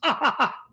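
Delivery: high-pitched burst of laughter. Real, not acted. Host joking to his co-host, high laugh. Joking about beating himself with sandals.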